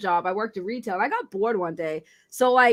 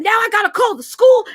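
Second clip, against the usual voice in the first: loud, much higher, shouting; mad mom; 10 semitones higher, 9 dB louder than usual. Retold or imitated. Furious mother shouts she must call school. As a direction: yell it like a fed-up mom. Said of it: "when she was mad".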